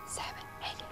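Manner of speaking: soft whispered numbers; whisper counting. Shown as a demonstration. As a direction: whisper them under the beat. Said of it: "Can you whisper count with me?".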